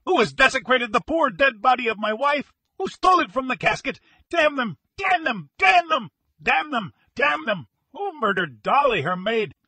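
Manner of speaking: very high, loud hysterical outburst; distraught widower screaming in grief and rage; frantic shrieking. A narrator's reading. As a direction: shriek every accusation, half out of your mind. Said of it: "he shrieked".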